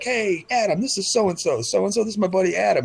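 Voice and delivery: high, bright-mannered host voice; mock chirpy introduction. Showing off a friend's car to coworkers, high mock-chirpy intro. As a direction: introduce them in a high show-off voice. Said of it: "I'll be like".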